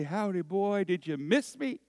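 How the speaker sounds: lightly acted dog-owner voice; greeting a dog. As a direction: greet an old dog like a pal.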